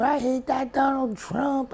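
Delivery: high, drawn-out mock-kid complaint; ranting child caller. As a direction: mimic an angry twelve-year-old on talk radio. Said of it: "this is twelve-year-old Johnny from Des Moines".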